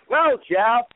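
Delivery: high, halting, unsure voice; meek hesitant contestant. Retold or imitated. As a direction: squirm through the answer. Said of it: "Artis goes".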